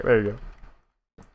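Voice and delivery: slow cracking voice. Shown as a demonstration. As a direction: crack the voice on purpose. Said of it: "Special just for you... voice crack".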